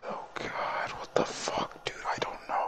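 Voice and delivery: hushed, voiceless dread; frightened whisper. Real, not acted. Player to friend in a scary game, frightened whisper. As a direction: whisper it, scared to be heard.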